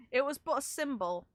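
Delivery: ordinary talk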